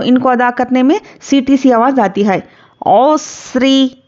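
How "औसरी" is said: औसरी recited very slowly, each sound drawn out evenly; slow recitation. Shown as a demonstration. Teacher shows students how to recite it, slow drawn-out chant. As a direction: chant औसरी long and measured, like in prayer. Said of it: "इस तरह से".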